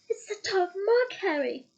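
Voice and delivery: high, pleading moan of fear; frightened moan. Acted. Hermione moaning to Harry in fear. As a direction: moan it out, scared and urgent. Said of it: "Hermione moaned".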